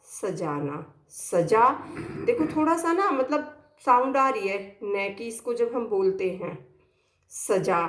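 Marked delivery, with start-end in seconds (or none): none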